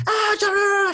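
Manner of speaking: loud, very high mock-Hebrew wail; crazed man shouting. Man barging into the toilet, loud crazed yelling. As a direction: yell nonsense like a madman. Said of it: "going fucking crazy".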